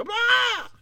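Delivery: very high peacock squawk; peacock cry. Acted. Hat feather shooting straight up, high peacock squawk. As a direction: shriek like a startled peacock, sky-high. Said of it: "Like a peacock".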